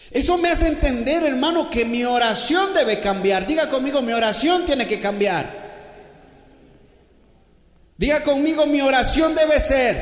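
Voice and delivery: loud, high, fervent sermon delivery; preaching at full force. Acted. Preacher shouting Paul's resolve to the church. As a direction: thunder it out to the congregation. Leads congregation in call and response.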